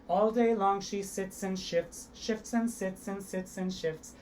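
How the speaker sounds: slow, crisp sits and shifts; slow tongue twister. Shown as a demonstration. Teacher demonstrates the tongue twister slowly and crisply. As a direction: articulate every sound slowly. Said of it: "Try that one with me".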